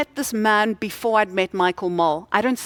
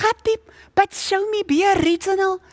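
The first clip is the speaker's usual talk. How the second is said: much higher, pushy mock wife; comic wife voice; 11 semitones higher, 3 dB louder than usual. Wife insisting her husband be original, in a much higher, pushy mock voice. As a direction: demand it like a hammed-up nagging wife.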